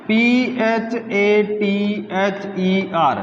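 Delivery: spelling aloud slowly for students. Shown as a demonstration. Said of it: "ऐसे नहीं लिख सकता".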